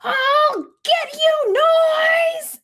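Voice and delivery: high loud slow threat; angry cry. Acted. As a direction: shout it as a battle cry. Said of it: "Owl said".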